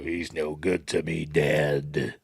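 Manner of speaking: slow, low bounty hunter voice; low, deliberate character voice; bounty hunter impression. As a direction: draw it out like a menacing film villain. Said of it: "can't remember what his voice is like".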